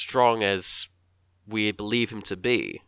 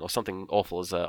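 ordinary delivery

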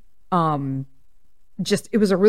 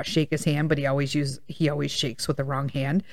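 ordinary delivery

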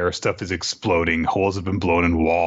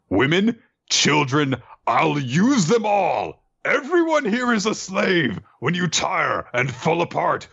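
hammed-up villain Kaido; 5 semitones higher than usual. Kaido threatening the defeated country, high and gloating. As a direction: gloat over the slaves like a cartoon tyrant. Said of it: "he says".

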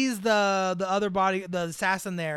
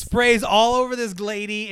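ordinary delivery

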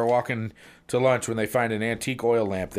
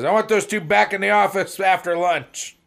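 high, loud bossy order; much higher and louder boss command; stern manager; 11 semitones higher, 8 dB louder than usual. Acted. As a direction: put the staff back in their place. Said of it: "Manager says".

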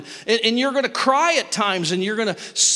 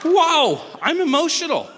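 high, louder startled exclamation; surprised at himself; 8 semitones higher, 4 dB louder than usual. Retold or imitated. Realizing he cares for the dog, startled and louder. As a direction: catch yourself off guard, amazed. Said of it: "Then I was like".

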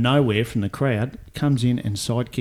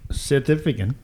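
put-on South African accent on request; clipped South African take; 3 semitones higher than usual. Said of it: "in your South African accent".

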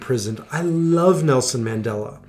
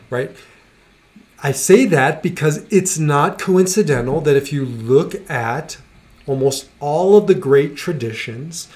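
usual speaking voice

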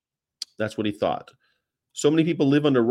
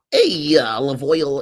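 slow, drawn-out cartoon sailor call; Popeye voice; 4 dB louder, slower than usual. To his sweetheart, a slow cartoon sailor call. Popeye impression calling Olive Oyl.

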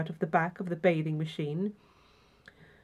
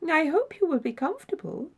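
high, gently attentive host; polite concern for a guest's comfort; courteous solicitude; 8 semitones higher than usual. A narrator's reading. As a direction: offer it kindly in a raised voice. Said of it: "said with courteous solicitude".

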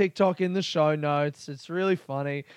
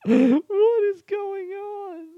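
very high squeal through laughter; real, loud, wheezing laughing fit; helpless laughter; 6 dB louder, much slower than usual. Real, not acted. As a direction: squeeze the words out mid laughing fit.

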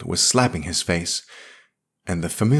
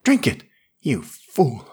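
higher, sharp irritable order; 5 semitones higher than usual. A narrator's reading. Yarrell forcing whiskey on Smith, irritably. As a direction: push the cup on him, annoyed. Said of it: "Yarrell said irritably".

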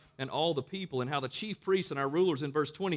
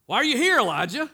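loud, much higher call to Elijah; God calling out; 10 semitones higher, 10 dB louder than usual. Acted. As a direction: call the question across a distance.

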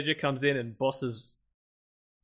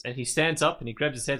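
ordinary delivery

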